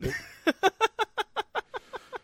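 high, loud burst of laughter; high staccato laughing fit. Real, not acted. Laughs at insane price rise.

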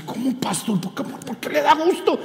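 put-on high, startled boy's voice; boy baffled by the pastor, high voice; puzzled boy. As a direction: play the confused teenager. Boy's puzzled reply, quoted.

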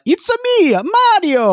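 a cartoon Italian accent, playing Mario. Host does a hammed-up Italian accent for the co-host. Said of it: "I don't know an Italian accent".

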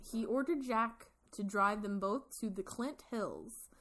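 usual speaking voice

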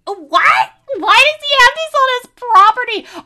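high shocked disbelief; baffled what. Retold or imitated. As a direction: squeal it in total confusion. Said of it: "I'm just, I'm like".

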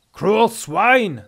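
angry Nordic warrior shout; husky Nordic captive. A narrator's reading. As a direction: hurl the insult in a husky Nord voice. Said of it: "This voice was husky and distinctly Nordic".

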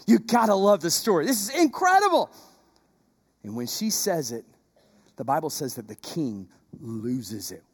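low, soft sermon narration; hushed storytelling. Acted. Preacher telling the congregation the story, hushed. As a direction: draw the congregation in quietly.

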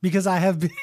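hammed-up Russian accent. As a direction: explain yourself in a fake Russian accent. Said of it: "I have the Russian accent".

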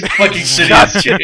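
ordinary talk

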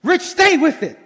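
lightly voiced coaching himself mid-run, with a laugh; a hint of a coach cheering on a tired runner; self pep talk. Pastor imitating himself on a run, mild laughing pep talk. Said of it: "I gotta start talking to myself".

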